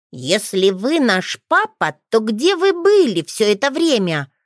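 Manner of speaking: put-on a boy interrogating his father; stubborn child pressing hard. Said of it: "упрямо продолжал допрос Леонард".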